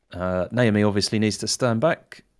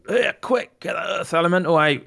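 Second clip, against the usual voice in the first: urgent raised voice, pitched high; Griff yelling over; 6 semitones higher, 4 dB louder than usual. Acted. Griff orders Naomi to summon the elemental, yelling. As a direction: call out a hurried order in character. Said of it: "Griff is going to yell over to Naomi".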